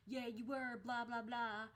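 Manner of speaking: mock taunting blah-blah voice; mocking retort. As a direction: mimic their nagging dismissively. Mimics harassers' taunting reply.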